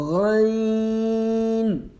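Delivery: غین stretched from the throat like gargling; throaty gargled غین; gargled Ghain. Shown as a demonstration. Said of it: "like gargling".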